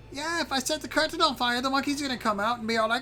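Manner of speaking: very high-pitched worried dragon; high, fretful little creature; Zarf, nervous. Acted. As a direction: make anxious excuses in a squeaky high voice. Game master plays nervous Zarf.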